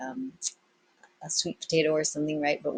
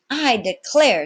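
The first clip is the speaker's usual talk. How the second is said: sailmaker exclaiming; 7 semitones higher, 8 dB louder, slower than usual. Acted. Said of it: "said Trot".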